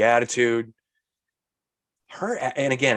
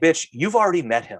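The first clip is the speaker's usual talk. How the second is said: indignant retort; 6 semitones higher, 4 dB louder than usual. Retold or imitated. Indignant retort to rude character.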